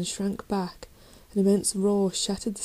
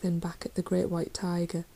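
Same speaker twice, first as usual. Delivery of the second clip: plain reading voice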